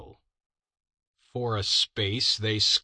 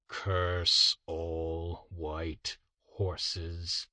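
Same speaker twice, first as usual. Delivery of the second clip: very slow, softer curse on the horses; muttered curse; 3 semitones lower, 3 dB quieter, much slower than usual. Acted. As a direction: drag the curse out grimly. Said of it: "his curse included".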